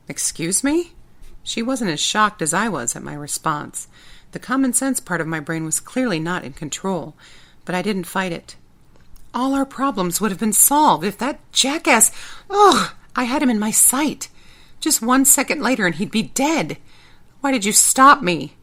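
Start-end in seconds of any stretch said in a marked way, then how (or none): 0.1-0.9 high, shocked question. Acted. Shocked reply. 9.3-18.6 loud, high furious outburst. Acted.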